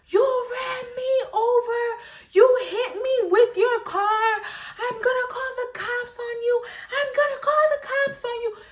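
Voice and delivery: high, shrill-pitched crying shout; angry lady flipping out. Retold or imitated. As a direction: wail at her like a hysterical stranger. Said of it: "Flipping out on me".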